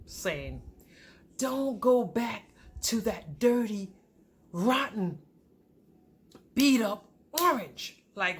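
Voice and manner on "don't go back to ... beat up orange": slow, weighty pulpit delivery; preaching pastor. Retold or imitated. Pastor warning his congregation, preaching slowly. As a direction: preach it word by word, with long pauses. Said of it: "the pastor was preaching a message".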